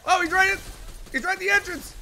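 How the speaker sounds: high, loud frantic callout; panicked shout. Real, not acted. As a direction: yell where he is. Enemy spotted at the entrance.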